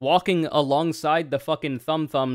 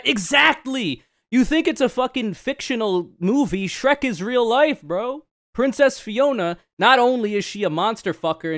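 high, loud, worked-up rant; eager fan pitch, voice jumping up; excited; 7 semitones higher, 4 dB louder than usual. Real, not acted. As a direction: get fired up about Shrek being real. Excited rant about Shrek.

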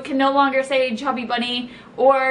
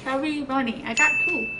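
mushy, garbled mouthful; cheeks stuffed full. Real, not acted. Mouth crammed with marshmallows.

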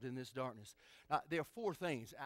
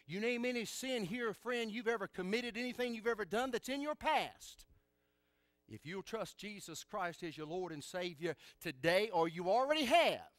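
raised, urgent pulpit delivery; fervent preaching; 4 semitones higher, 6 dB louder than usual. Real, not acted. Preacher urging friend to trust Jesus, fervent preaching. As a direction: press the appeal home, rising to a loud finish.

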